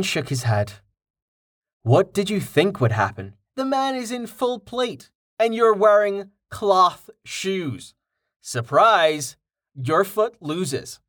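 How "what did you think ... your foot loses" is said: lightly voiced young man, dry teasing; Rain, wry. A narrator's reading. Rain teases Jameis about kicking armored Carten, wry amusement.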